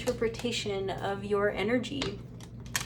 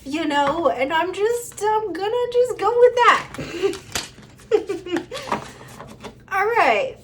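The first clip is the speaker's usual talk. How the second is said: high, louder giggly rambling; giddy higher laughing talk; flustered laughing; 7 semitones higher, 6 dB louder than usual. Real, not acted. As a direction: laugh through the excuse. Laughing flustered about card.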